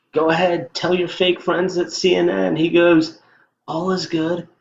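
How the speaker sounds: high, loud mock Trump voice; imitating Trump. Vlogger imitates Trump dismissing CNN, high-pitched. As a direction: play Trump brushing off the reporter.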